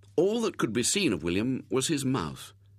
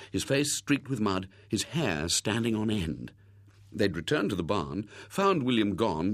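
ordinary delivery, nothing marked

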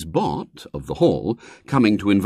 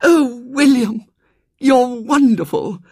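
high, slow, delighted gasp; a girl thrilled by his offer; overjoyed girl; 7 dB louder, slower than usual. A narrator's reading. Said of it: "The little girl beamed once more".

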